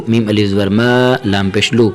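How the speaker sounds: ordinary talk, nothing marked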